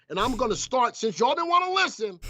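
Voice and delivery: high-pitched scolding at the chat; exasperated teacher scolding the class. Real, not acted. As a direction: tell them off for not listening. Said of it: "Joey's gonna say that I'm yelling".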